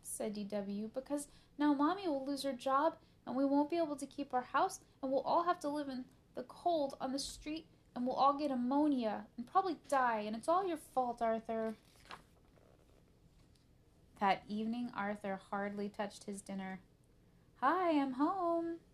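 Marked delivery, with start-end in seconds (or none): 0.9-11.8 high, loud, escalating catastrophe; melodramatic rant. Acted. D W blaming Arthur for ruin, melodramatic. 17.6-18.8 high, loud, drawn-out singsong greeting; calling out. A narrator's reading. Mom coming home to the family, calling out. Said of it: "called Mom".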